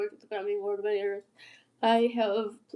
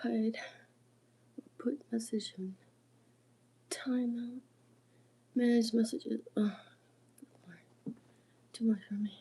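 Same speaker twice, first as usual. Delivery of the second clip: softly talking to yourself while fiddling with settings; muttering to oneself; 8 dB quieter than usual. Real, not acted. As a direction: mumble the on-screen options under your breath. Reading live settings to herself.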